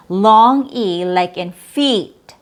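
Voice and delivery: feet with a long, drawn-out ee. Shown as a demonstration. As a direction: slowly stretch "feet".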